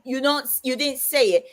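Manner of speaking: usual speaking voice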